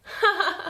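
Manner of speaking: a quick laugh between sentences; short laugh. Real, not acted. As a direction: let out a little chuckle. Laugh after remark on taken land.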